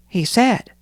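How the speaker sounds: plain reading voice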